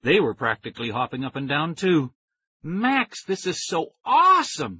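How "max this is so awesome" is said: put-on high, thrilled girl's voice; excited cry. A narrator's reading. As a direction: gush it out, bouncing with joy. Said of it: "Tara cried".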